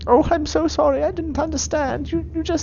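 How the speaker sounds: high, stammering apology; timid Irish worshipper, apologetic. Acted. Worshipper apologising to the god, high and apologetic. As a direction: grovel in a high, faltering voice. Game master voices frightened worshipper.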